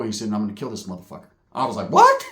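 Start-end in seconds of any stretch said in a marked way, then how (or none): 0.3-1.3 hushed threat. Retold or imitated. Hell's Angel states his plan quietly. 1.9-2.2 high, loud startled yelp; a sudden high-pitched, loud what; shocked what. Retold or imitated. Shocked at the murder plan.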